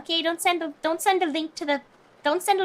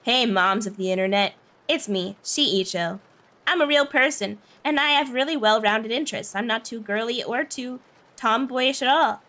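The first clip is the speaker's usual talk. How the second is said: lower, measured "normal person" intro; fake real person voice; 5 semitones lower than usual. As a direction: play a very normal, grounded girl. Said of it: "Interviewing for the real person job".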